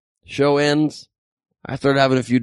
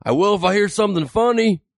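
raised-pitch heckler's retort; defiant heckler; 7 semitones higher than usual. Retold or imitated. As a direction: mimic a disgruntled heckler talking back. Acted heckler answering back at comedian.

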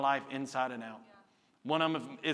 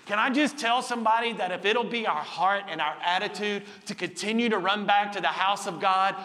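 high, loud, driving sermon voice; full-force preaching; 7 semitones higher, 6 dB louder than usual. Real, not acted. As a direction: preach it up high, punching key words.

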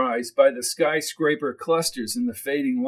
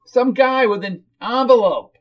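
high, louder whiny woman's voice; whiny character voice, pitched up; whiny hostess; 8 semitones higher, 6 dB louder than usual. Acted. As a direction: gush at the guest in a high whine.

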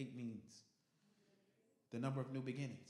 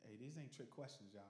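normal talking voice

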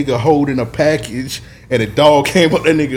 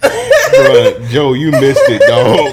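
laughing; 3 dB louder, much slower than usual. Real, not acted. Laughing aside to absent friend.

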